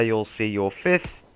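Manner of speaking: usual speaking voice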